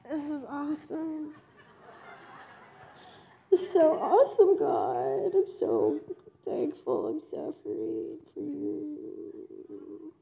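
slow, strained forced cheer breaking into a plea; weary fake enthusiasm. Preacher mocking a tired believer begging God, tearful. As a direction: sound worn out while pretending to be thrilled. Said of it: "ten years of that and you're like".

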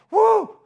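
sudden high, loud "woo"; mock frightened whoop. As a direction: yelp as if startled out of your skin. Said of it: "They're terrified. They're going".

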